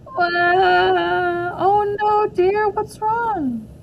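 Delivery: high, loud then slow worried ask; a teacher rushing to a crying child; concerned surprise. Acted. Teacher finds May upset.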